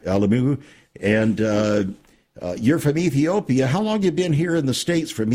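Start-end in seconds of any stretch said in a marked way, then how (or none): 0.1-0.6 Alemu with the stress moved to the first syllable, E-le-mu; stress corrected. Shown as a demonstration. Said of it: "trying to get the emphasis on the right syllable here".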